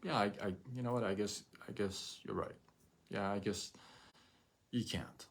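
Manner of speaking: a hint of resigned defeat; resigned agreement. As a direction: shrug and concede quietly. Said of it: "I would have said".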